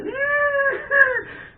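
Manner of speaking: high, loud mock wail; exaggerated high-pitched cry. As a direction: imitate kids bawling at the top of their voices. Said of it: "they were crying".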